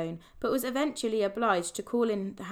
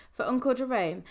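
plain reading voice

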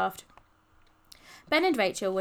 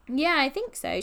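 slightly raised call; shouting; 5 semitones higher, 4 dB louder than usual. A narrator's reading. Rachel answering Polly about the shop, mild shout. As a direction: call ahead with breath just regained. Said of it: "shouted Rachel".

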